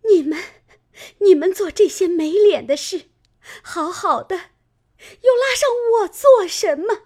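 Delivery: high, tearful protest breaking into a sob; a wronged maid, wailing her complaint; crying in anger. A narrator's reading. Ping'er, beaten by her mistress, cries out high and tearful. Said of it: "只气得干哭，骂道".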